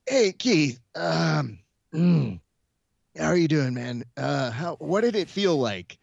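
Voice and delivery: imitating a worn-out, cracking commentator voice; mock a voice about to give out; strained voice. Imitating a tired commentator interviewing Keith, strained and cracking. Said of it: "I could hear the strain in his voice".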